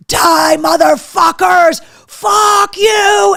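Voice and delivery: high, loud furious scream; screaming at the top of lungs. Retold or imitated. Battling wasps with a power washer, full-lunged scream. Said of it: "screaming this at the top of my lungs".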